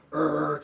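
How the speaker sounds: loud imitated dog growl; a loud mock guard-dog snarl. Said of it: "he instantly went from".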